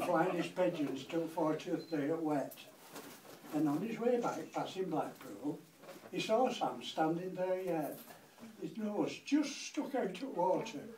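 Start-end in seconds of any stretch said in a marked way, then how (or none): none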